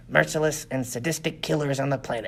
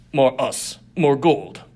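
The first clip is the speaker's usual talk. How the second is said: louder, broken-speech thug voice; brutish gang boss; 6 dB louder, slower than usual. Acted. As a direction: bark it like a greedy brute. Diumph demands more.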